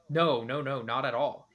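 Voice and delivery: ordinary talk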